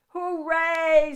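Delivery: high, loud cheer. A narrator's reading. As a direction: cheer like happy children. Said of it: "said the children".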